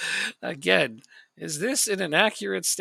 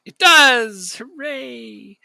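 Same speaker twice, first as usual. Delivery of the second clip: loud happy cheer; excited cheer; 8 semitones higher, 14 dB louder, much slower than usual. Real, not acted. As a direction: cheer the goal. Celebrating a goal.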